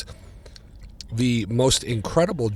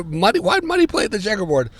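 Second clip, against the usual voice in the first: much higher, fast disbelief; incredulous; 10 semitones higher, faster than usual. Retold or imitated. Fan questioning why Muddy would play there, incredulous. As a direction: question how this could be.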